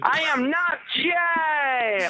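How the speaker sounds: put-on drawn-out shout in a New York accent; hammed-up, slow New York protest. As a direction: holler it high, New York style. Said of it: "That was my best New York accent".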